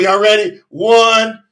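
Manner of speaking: ordinary talk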